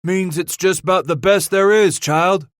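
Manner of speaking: high, loud, fond explanation; old trapper voice. Acted. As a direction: teach the greenhorn with gusto. Potts explains to Bass.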